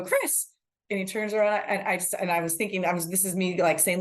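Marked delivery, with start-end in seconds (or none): 0.0-0.5 high, drawn-up calling of a name; retold call-out. Reporter greeting the player at the door, high call. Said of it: "opens the door and I go".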